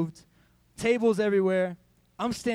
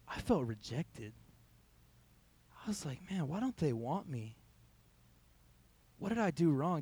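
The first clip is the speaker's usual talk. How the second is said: hurt, softer voice; 2 semitones lower, 6 dB quieter than usual. Real, not acted. Admitting the hurt to the congregation.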